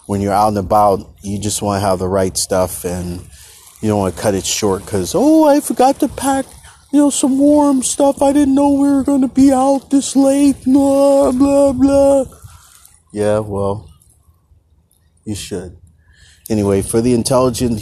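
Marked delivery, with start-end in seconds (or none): 5.1-12.2 high mock whine of an unprepared hiker; whiny complainer. Mocking hikers who forgot warm clothes in a high whiny voice. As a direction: squeak it out like a silly whiner.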